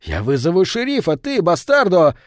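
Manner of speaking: angry threat. A narrator's reading. Barman reaching for the phone threatens the albino, angry outburst. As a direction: rise to a loud insult at the end.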